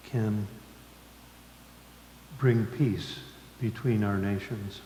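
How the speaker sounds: usual speaking voice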